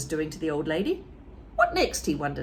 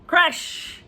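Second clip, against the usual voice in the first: crash; 9 semitones higher, 5 dB louder than usual. Acted. Sound effect narration.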